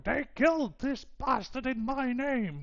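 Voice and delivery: high-pitched, boisterous god voice; boisterous high-voiced deity staking a claim. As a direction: proclaim it boisterously, pitched well up. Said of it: "a very boisterous voice".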